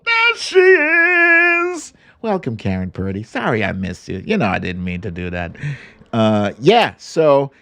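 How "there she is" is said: sing-song greeting. Real, not acted. Greeting a late listener.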